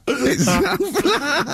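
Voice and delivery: very high, slow, said through laughter; "flat" dissolving into a laugh. Real, not acted.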